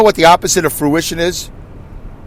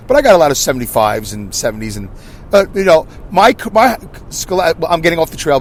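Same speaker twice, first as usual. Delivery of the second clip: ordinary talk, nothing marked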